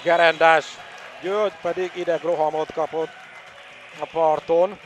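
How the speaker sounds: loud, high goal shout; excited goal call. Real, not acted. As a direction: yell the goal out, thrilled. Home goal scored.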